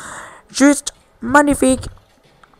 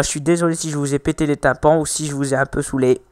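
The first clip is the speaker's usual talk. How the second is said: ordinary delivery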